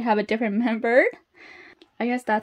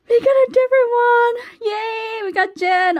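high, loud celebratory shriek; ecstatic squeal; 12 semitones higher, 6 dB louder than usual. Real, not acted. Fan celebrates a different member, ecstatic high squeal. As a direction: squeal with joy, way up in pitch.

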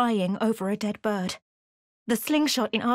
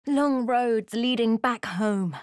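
ordinary talk, nothing marked

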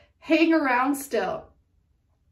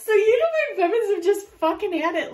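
higher, louder and fast; giddy rush of excitement; excited outburst; 8 semitones higher, 6 dB louder, faster than usual. Real, not acted. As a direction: blurt it out all worked up. Excited reading, laughing.

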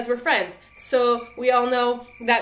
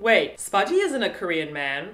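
ordinary delivery

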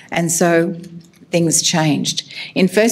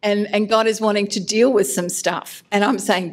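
ordinary delivery

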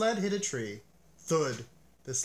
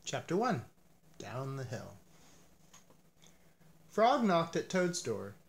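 ordinary delivery, nothing marked

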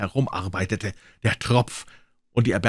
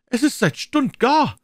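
much higher, louder, slow; shrill housewife; 5 dB louder, slower than usual. Acted. Women lamenting the overcooked cabbage, high and slow. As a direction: wail it out, outraged.

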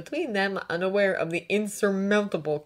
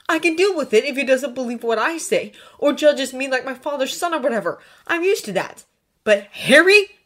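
raised, loud, hurt protest; heated Draco; 8 semitones higher, 8 dB louder than usual. A narrator's reading. Draco explaining his grudge to Hermione, heated. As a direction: argue it out, loudest at the end.

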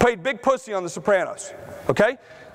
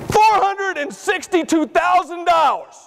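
laughing reveal; 8 semitones higher, slower than usual. Real, not acted. Revealing a shocking figure.

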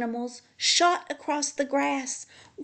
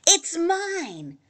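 high-pitched yell of protest; possessive yell; 8 semitones higher, 4 dB louder than usual. A narrator's reading. Hickory argues with Pecan over a nut, high yell. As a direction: yell back to claim it. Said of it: "yelled Hickory".

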